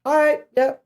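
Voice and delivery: high-pitched, drawn-back reluctant agreement; noncommittal elf. Acted. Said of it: "where the elves are just kinda like".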